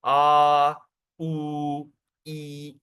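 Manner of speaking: A, U, E each held long and level; pulled vowels. Shown as a demonstration. Teacher demonstrates what pulling the vowel means, drawn-out demo. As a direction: hold out each of A, U, E like a steady note. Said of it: "that means you pull the vowel".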